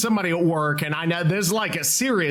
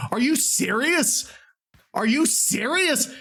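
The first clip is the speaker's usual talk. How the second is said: disbelief; 7 semitones higher than usual. Real, not acted. Stunned by AI voice clone.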